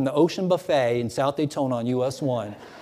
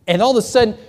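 ordinary talk, nothing marked

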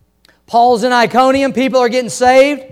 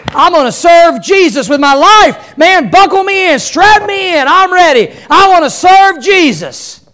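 loud, high, eager cry; all fired up, ready to go; eager new convert; 8 semitones higher, 7 dB louder than usual. Retold or imitated. New believer eagerly volunteers to serve Jesus, loud and high.

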